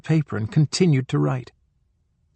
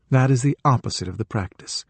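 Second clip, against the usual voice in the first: ordinary delivery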